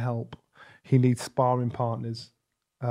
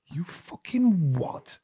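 incredulous; 2 semitones higher, 5 dB quieter, much slower than usual. Retold or imitated. Smile turns to disbelief.

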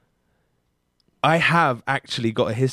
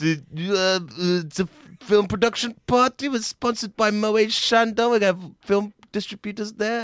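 higher, lightly voiced awkward guy; party host mock-dodging the request; mild hesitant brush-off; 9 semitones higher than usual. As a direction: hedge politely, a hint of squirming. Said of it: "He goes".

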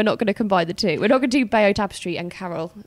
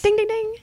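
quiz bell ding; 10 semitones higher than usual. Acted. Quiz host sound effect.

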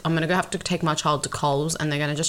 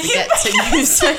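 laughing; 10 semitones higher, 6 dB louder than usual. Real, not acted. Laughing at own slip.

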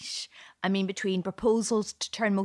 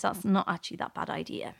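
ordinary delivery

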